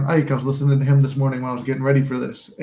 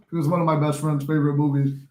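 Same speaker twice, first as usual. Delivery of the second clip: ordinary talk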